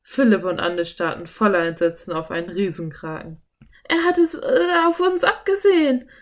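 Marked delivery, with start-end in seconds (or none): 3.9-6.1 put-on high, loud frightened girl's cry; alarmed stammer. A narrator's reading. Anne spotting the giant octopus coming, alarmed stammer. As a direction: stammer it in wide-eyed alarm. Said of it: "stammelte Anne mit weit aufgerissenen Augen".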